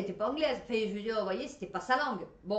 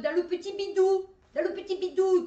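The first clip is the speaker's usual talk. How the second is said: emphatic excitement; 7 semitones higher, 8 dB louder than usual. Real, not acted. Emphatic repetition about womb.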